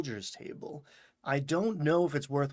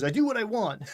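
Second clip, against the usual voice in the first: defiant; 9 semitones higher, 5 dB louder, faster than usual. Retold or imitated. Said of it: "And he goes".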